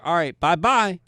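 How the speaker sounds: put-on loud, sing-song taunting farewell like a little kid; mocking childish bye-bye. Security guard taunting the banned man out the door, loud childish bye-bye. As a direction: wave him off with a mocking child's goodbye. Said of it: "Waving my hand like a little fucking kid".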